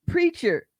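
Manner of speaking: retold yell. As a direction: call it out high, mimicking her correction. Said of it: "had to yell".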